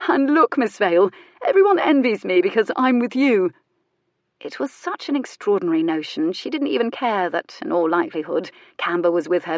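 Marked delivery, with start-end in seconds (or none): 0.1-3.5 higher and louder, vain and delighted; lightly voiced preening lord. A narrator's reading. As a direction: show off to the crowd. Camber preening in story.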